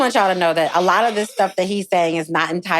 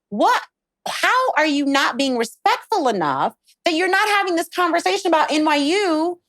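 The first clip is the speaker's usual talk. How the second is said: high, indignant retort; upset; 6 semitones higher than usual. Retold or imitated. Woman recalling her thoughts in the green room, upset. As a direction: demand it in a raised pitch, affronted. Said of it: "the reason why I was upset".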